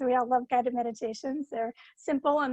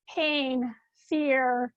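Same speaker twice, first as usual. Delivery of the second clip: ordinary talk, nothing marked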